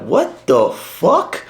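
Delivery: high, drawn-out incredulous question; retold disbelief. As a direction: stretch each word in baffled mock shock. Teller retells own disbelief.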